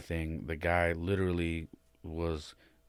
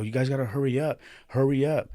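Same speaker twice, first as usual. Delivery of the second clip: urgent plea; 8 semitones higher, 7 dB louder than usual. Retold or imitated. Urging the paramedics to hurry.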